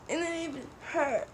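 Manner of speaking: high whiny imitation; whiny mock girl. Pushed classmate complaining to friends, high whiny mock voice. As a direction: whine it like a hurt classmate.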